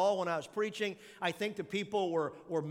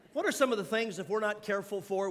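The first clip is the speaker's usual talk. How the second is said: ordinary talk, nothing marked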